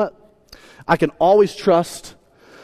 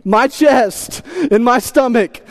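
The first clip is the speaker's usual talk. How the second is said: ordinary talk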